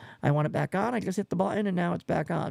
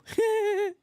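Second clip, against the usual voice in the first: high squeaky laugh; 3 dB louder than usual. Real, not acted. Laughs after mentioning Omegle.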